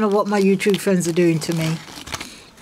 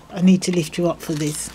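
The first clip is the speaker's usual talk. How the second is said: usual speaking voice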